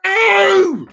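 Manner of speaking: high, drawn-out yelled NO; acted outburst of exasperation; exasperated shout. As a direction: cry out the refusal, high and indignant. Acted reply to cashier's next question.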